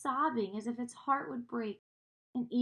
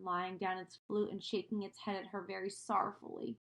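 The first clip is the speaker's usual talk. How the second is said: plain reading voice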